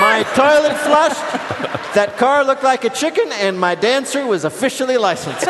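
high, loud hammed-up exultation; mock elation. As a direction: crow it like a mock-triumphant mayor. Host voices the mayor exulting.

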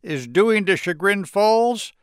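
high-pitched, indignant scolding; dramatic announcement. A narrator's reading. Girl blames Harry for town's havoc, dramatic announcement. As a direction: proclaim it with alarm, pitch way up. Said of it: "Teresa announced".

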